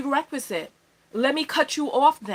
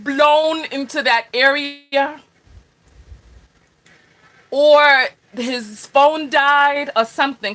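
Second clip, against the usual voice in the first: slow, loud and deliberate; slow loud insistence; 6 dB louder, slower than usual. Real, not acted. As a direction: drag it out loudly in denial. Host blames the tower, loudly.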